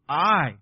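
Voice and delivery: I said loud and firm; stressed I with weight; emphatic I. Shown as a demonstration. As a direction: lean hard on I. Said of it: "emphatic in the Hebrew".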